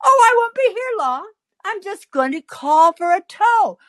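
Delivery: high singing-out cheerfulness; put-on cheery lilt; most cheerful voice. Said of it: "in my most cheerful voice".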